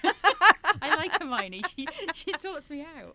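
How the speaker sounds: high laughing burst, then talking through the laugh; real laughter breaking into the words. Real, not acted. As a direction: giggle your way through it. Laughing about her Hermione part.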